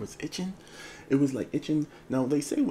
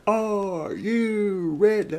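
high, drawn-out wrestler's roar; Triple H; 9 semitones higher, 6 dB louder, much slower than usual. Acted. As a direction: stretch each word into a loud ring cry. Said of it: "Triple H to come in here and just be like".

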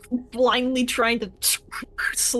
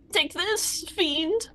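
a hint of the attacking heroine; lightly voiced battle cry; 8 semitones higher, slower than usual. Player voices character's attack line.

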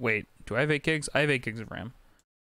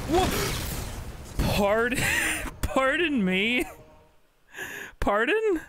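mock disbelief; 12 semitones higher, much slower than usual. Real, not acted.

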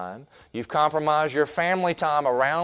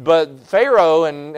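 ordinary talk

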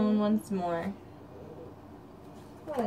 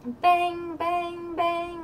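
banging knock; 7 semitones higher, much slower than usual. Acted. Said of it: "went the little wooden door".

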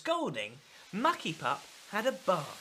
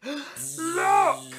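sudden high, loud cry of alarm; alarmed shout; 8 semitones higher, 6 dB louder than usual. Acted. Pup spots the bee swarm, loud alarmed cry. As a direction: shout it as you spot danger.

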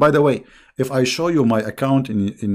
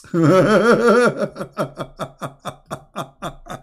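loud, high belly laugh trailing out; helpless drawn-out laughter; long real laugh; 6 semitones higher, 3 dB louder than usual. Real, not acted. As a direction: burst out cackling and keep going. Cracking up at the verse.

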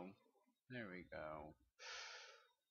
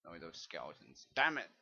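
normal talking voice

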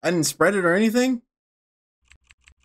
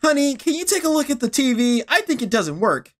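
higher, sweet wife voice; higher wife asking about the TV; 8 semitones higher than usual. Acted. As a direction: ask the husband sweetly. Voicing the wife character.

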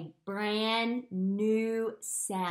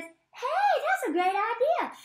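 much higher, cheerful cartoon letter voice; letter H talking; 11 semitones higher than usual. Teacher voicing letter H to letter C, high and cute. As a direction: play the H as a sweet little character. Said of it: "And the C says".

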